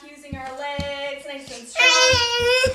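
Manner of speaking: slow, loud, sing-song coaching; energetic cue. Shown as a demonstration. Yoga teacher cheering kids on a pretend hike, loud sing-song cue. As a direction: pump the kids up, stretched and loud. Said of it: "leading kids through moves".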